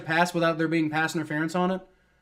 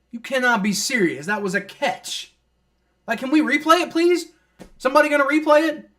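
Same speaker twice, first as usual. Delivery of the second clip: high, loud exasperated pleading; real frustrated fan ranting at refs; indignant complaint; 9 semitones higher, 5 dB louder than usual. Real, not acted. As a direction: demand the replay.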